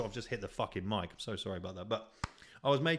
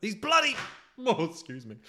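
laughing outburst; 9 semitones higher, 6 dB louder than usual. Real, not acted. Bursts out then laughs.

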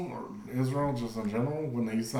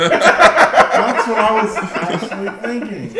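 high, loud words through laughter; laughing agreement; 9 semitones higher, 14 dB louder, slower than usual. Real, not acted. Co-host owning up to the same thought, laughing hard. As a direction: crack up while agreeing. Cracking up at the crying joke.

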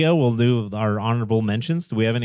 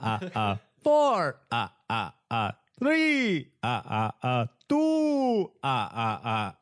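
Count counting voice; slower than usual. Shown as a demonstration. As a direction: count down like the Sesame Street vampire. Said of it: "how you do the count?".

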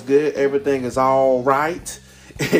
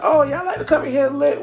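mocking onlooker; 12 semitones higher than usual. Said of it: "Some people like".

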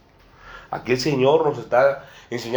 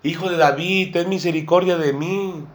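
higher, imitated calling out to the Lord; blind men calling out; 6 semitones higher than usual. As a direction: voice the blind men crying for mercy. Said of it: "iban gritando también ciegos". (imitando a los ciegos que gritan, más agudo)

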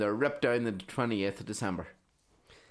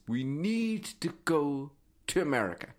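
mock American accent; 4 semitones higher than usual. Director humbling the actor in front of everyone, mock American accent. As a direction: imitate someone sending up a bad American accent. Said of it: "I tried to do an American accent".

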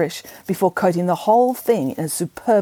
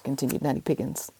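plain reading voice

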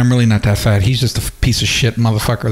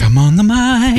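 much higher, playful sing-song; high-pitched sing-song; 11 semitones higher, slower than usual. Acted. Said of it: "I'm getting on the mic now".

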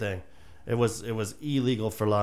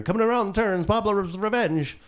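higher, louder horse-race call; race announcer; 10 semitones higher, 3 dB louder than usual. Pretend. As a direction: call it like the final turn at the track.

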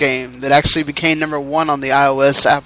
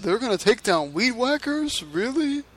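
high, soft, incredulous questioning; imitated clueless idiot; 8 semitones higher, 5 dB quieter, slower than usual. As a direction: mimic a baffled dimwit, pitched way up. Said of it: "some idiot would have said".

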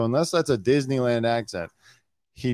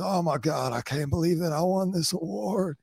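mock Memphis accent; 7 semitones higher, 3 dB quieter than usual. Mocking Austin Butler's award speech in a put-on Memphis accent. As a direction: send up his Memphis accent in the acceptance speech. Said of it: "Brad Pitt doesn't have a Memphis accent".

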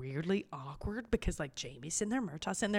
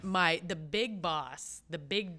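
usual speaking voice

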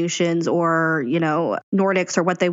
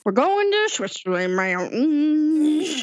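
goofy singsong; 9 semitones higher, much slower than usual. Pretend. Silly singsong about Switzerland.

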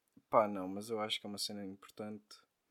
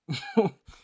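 loud high burst of laughter; 9 semitones higher, 10 dB louder than usual. Real, not acted. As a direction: let out a big high laugh. Big laugh.

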